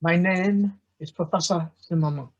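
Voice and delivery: bad French accent. Professor opening his history lecture in a bad French accent. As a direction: ham up a French accent like a comic detective. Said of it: "the very bad French accent learned from Peter Sellers".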